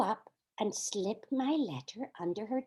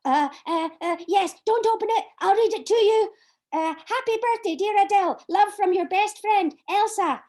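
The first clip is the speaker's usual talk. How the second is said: higher, louder flustered rush; flustered and nervous; 8 semitones higher, 7 dB louder than usual. Acted. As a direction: stammer eagerly, embarrassed. Flustered reading her birthday letter.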